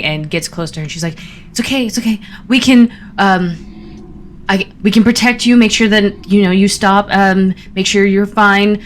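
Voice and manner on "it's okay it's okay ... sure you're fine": higher, louder, hurried comforting; urgent reassurance. Acted. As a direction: frantically promise to protect a friend. Frantic reassurance of dying friend.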